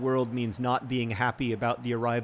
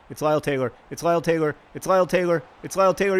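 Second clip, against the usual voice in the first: each repeat louder and higher; building excitement; 3 semitones higher, 6 dB louder, faster than usual. Real, not acted. Gamer watching his striker run, building excitement. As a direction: chant it with rising hope.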